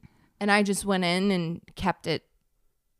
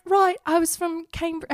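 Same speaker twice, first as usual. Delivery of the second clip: high-pitched put-on British accent; 9 semitones higher than usual. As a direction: pass yourself off as British, pitched up. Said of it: "thought I was really British".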